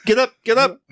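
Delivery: put-on high, sharp "get up" at a fallen kid; mock parent. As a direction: bark like a parent hauling a kid up.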